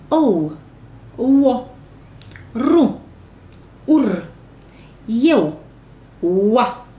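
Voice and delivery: each syllable read out loud and clear; teacher drilling syllables, loud and separate. Shown as a demonstration. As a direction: read every syllable for the class to repeat. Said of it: "din silabe".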